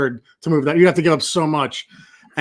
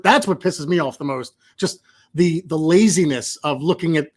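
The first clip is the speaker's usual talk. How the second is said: usual speaking voice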